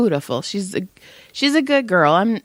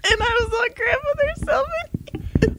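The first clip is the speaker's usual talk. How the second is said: laughing. Real, not acted. Still laughing while retelling.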